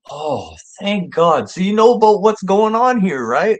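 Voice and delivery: loud, high retold outburst of relief; exaggerated relief. Acting out Ron's relief.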